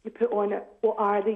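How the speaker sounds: normal talking voice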